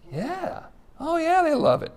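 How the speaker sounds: preacher sneering at celebrities' love of Jesus quotes; sarcastic yeah. Real, not acted. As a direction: drip irony on the yeah. Sarcastic answer to own question.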